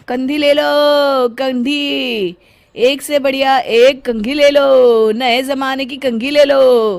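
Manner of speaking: loud, high, drawn-out vendor cry; street hawker call. Acted. Comb seller calling to passersby, loud slow hawker cry. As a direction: sing out your wares to the street.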